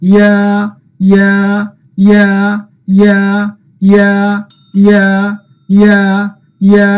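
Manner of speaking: ইয়া said crisply and clearly, again and again, for students to echo; call-and-repeat drill. Shown as a demonstration. Teacher modelling the letter name for students to repeat, a clear drilled ইয়া. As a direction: model ইয়া with a clear y-glide into a long open a, beat by beat. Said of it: "আপনারা সবাই আমার সাথে বলুন".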